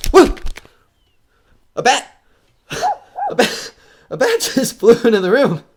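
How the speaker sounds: gasping, high, loud cries of alarm; startled fright. Acted. Man in a dark house startled by a bat, loud frightened cries. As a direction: jump out of your skin at the bat.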